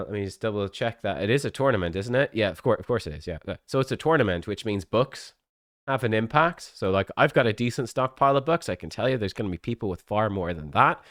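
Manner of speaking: normal talking voice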